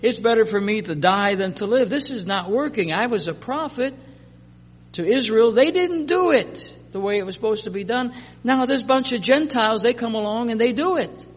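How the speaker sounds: whiny Jonah. Acted. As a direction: whine it like a sulking prophet. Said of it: "he said".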